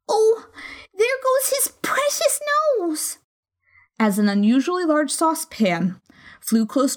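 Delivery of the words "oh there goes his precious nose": put-on high, shrill, alarmed girl's cry; alarmed young girl. A narrator's reading. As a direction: gasp in horror at the pan. Alice alarmed at flying pans.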